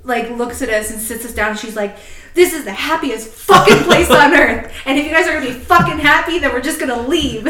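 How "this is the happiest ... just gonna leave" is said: loud, high furious yell; hammed-up screaming mom; mock scream. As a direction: scold the kids like a fed-up mom. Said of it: "she's just screaming at us".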